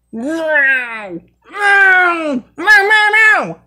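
rising high meows meant as a roar; mock Simba roar. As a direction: meow like a kitten trying to roar. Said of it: "Simba roar".

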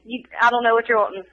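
ordinary delivery

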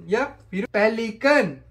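ordinary talk, nothing marked

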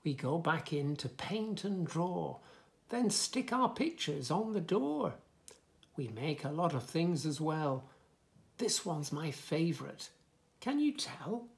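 lively, sing-song story reading; reading to children. Acted. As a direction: bounce the rhymes for little listeners. Reads book text.

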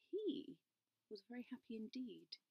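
hushed whisper; whispered storytelling aside. A narrator's reading. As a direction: let them in on a secret. Narrator hushed secret aside.

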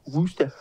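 "Worcester" tried out with a long "oo"; unsure pronunciation. Real, not acted. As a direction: hesitantly say "Worcester" as "WOO-stah". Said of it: "Is that how you pronounce it?".

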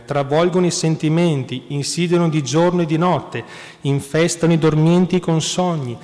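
citazione letta con forza, più forte; predica infuocata. Acted. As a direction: proclamare la citazione come un predicatore appassionato. Reads saint's quote forcefully. (lettura solenne e veemente)

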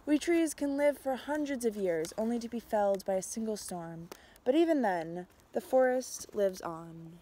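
usual speaking voice

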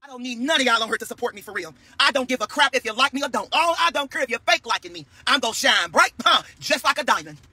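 ordinary talk, nothing marked

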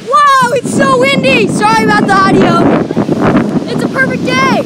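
yelling over a storm; loud, high shouting in wind. Real, not acted.

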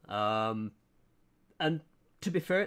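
ordinary delivery

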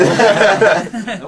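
slow words through a laugh; amused and drawn out. Real, not acted. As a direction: laugh it out, delighted. Laughing at joke.

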